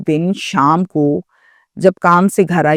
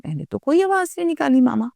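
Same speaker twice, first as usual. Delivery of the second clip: put-on innocent child denial; 7 semitones higher, 6 dB quieter than usual. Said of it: "تو آگے سے کہتی ہے".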